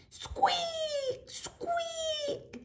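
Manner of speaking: very high squealing squeaks; squeaking mice. Acted. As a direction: shriek it like a tiny mouse. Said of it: "ξεφώνιζαν". (τσιριχτό ποντικίσιο σκουίκ)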